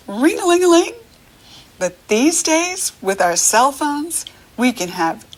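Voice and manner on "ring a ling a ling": very high trilling ring; phone ring. Shown as a demonstration. Teacher imitating an old phone ringing, very high and quick. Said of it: "It rings".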